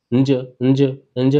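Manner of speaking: "नज" as ञ, through the nose with tongue on palate; repeated nasal "नज" as a pronunciation model; nasal palatal sound. Shown as a demonstration. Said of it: "नाक से आवाज निकालो".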